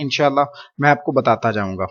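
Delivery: ordinary talk, nothing marked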